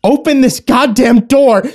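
high, loud, slow menacing demand; possessed wife ordering him to open up; furious demand. Acted. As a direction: spit each word at him.

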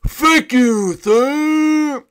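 high, loud cartoon princess; Raggedy Princess. Princess thanks the Ice King, high cartoon voice. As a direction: sing out the thanks in a high put-on voice. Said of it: "I'm Raggedy Princess".

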